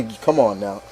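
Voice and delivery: ordinary talk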